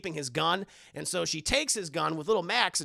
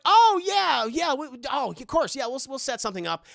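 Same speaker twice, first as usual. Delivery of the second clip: higher, loud start trailing off; flustered mom; 6 semitones higher, 6 dB louder than usual. Retold or imitated. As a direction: nervously agree to everything. Mother flustered by social worker.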